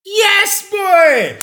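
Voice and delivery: high, loud triumphant cheer; excited shout. Acted. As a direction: yell it like a game show win. Host cheers the right answer.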